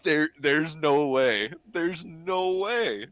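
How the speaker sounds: slow, drawn-out no way; disbelief. Retold or imitated. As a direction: stretch each word in awe. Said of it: "And I'm like".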